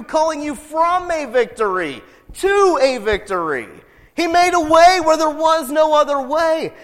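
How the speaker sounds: high, loud sermon voice; impassioned preaching. Acted. Preacher urging the congregation they've won the war, impassioned. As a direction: preach it with full conviction.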